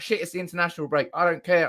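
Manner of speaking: rushed, fast patter; speaking really fast. Real, not acted. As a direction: rattle it off quickly. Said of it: "I'm speaking really fast".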